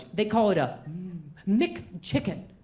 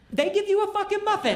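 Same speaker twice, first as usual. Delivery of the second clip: mock outrage at the joke; exasperated; 9 semitones higher than usual. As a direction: punch the punchline up high. Punchline outrage.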